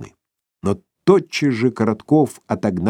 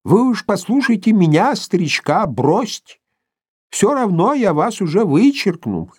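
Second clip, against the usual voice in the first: put-on old prospector; 11 semitones higher than usual. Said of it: "зашамкал он".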